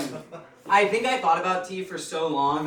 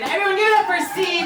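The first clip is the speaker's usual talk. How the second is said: fast, high, loud sing-song call for applause; hype call; 13 semitones higher, 6 dB louder, faster than usual. Real, not acted. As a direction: pump up the crowd. Host calls out applause.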